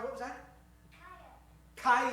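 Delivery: normal talking voice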